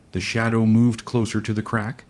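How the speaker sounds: ordinary delivery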